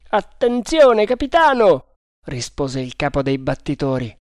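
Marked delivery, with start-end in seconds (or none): 0.1-2.0 combat callout. Acted. Allarme durante la caccia.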